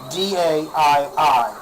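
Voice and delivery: slow letter by letter spelling; mock disbelief spelling "D A I I". Shown as a demonstration. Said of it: "a Y instead of an I".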